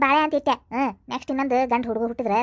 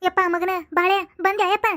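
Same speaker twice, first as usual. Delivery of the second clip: delighted greeting; 6 semitones higher than usual. Acted. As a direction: greet the son with joy, voice rising high. Mother greets returning son.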